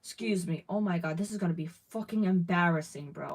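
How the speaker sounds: normal talking voice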